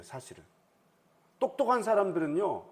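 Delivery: normal talking voice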